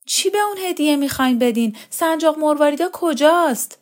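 high-pitched, heated demand; angry. A narrator's reading. Daughter demanding a gift from mother, angry. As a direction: fire the questions out crossly. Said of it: "لقا با خشم گفت".

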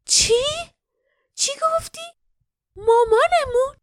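gasping, high and shocked; breathless. A narrator's reading. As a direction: gasp the words out. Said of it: "نفس زنان گفت".

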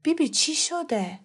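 put-on a small scared boy's question; worried child. A narrator's reading. Boy asks grandma what happened, worried and soft. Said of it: "با نگرانی گفتم".

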